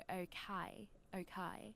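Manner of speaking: "Okay" in a British accent, second syllable like "kye"; British accent, "Okay" ending on a wide "kye". Shown as a demonstration. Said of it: "but you guys go".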